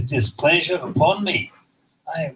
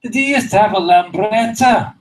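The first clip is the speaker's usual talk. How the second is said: higher and louder, a friendly booming question; Scotsman's punchline question; 6 semitones higher, 6 dB louder than usual. Retold or imitated. Big Scotsman asking the thief, higher louder hearty. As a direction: ask it with big sudden friendliness. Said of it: "He says".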